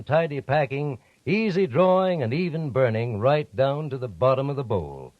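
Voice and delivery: normal talking voice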